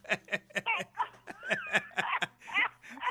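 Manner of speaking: high, helpless laughter; long hearty laugh. Real, not acted. Host finishing a comic anecdote, long high laughing. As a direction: crack up at your own tale.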